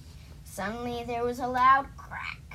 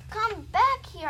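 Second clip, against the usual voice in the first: raised, higher storybook yell; 10 semitones higher, 6 dB louder than usual. Acted. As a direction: call out loudly and high to bring it back. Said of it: "I yelled".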